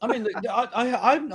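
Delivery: high words through laughter; giggling as you start; talking while laughing. Real, not acted. Laughing at shared joke.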